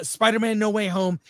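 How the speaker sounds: ordinary talk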